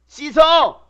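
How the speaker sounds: sharp motherly shout; mom's order. Retold or imitated. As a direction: bark a command at the kid.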